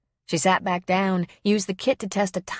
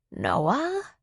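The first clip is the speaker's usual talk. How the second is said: put-on confused old woman; 4 semitones higher than usual. A narrator's reading. Liddy coming back to reality.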